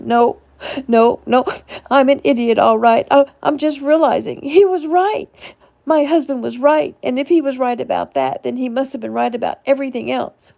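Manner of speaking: high, loud, self-blaming; upset wife insisting she's an idiot, tearful; distraught insistence. A narrator's reading. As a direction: talk over him in tears. She talks over him, distraught.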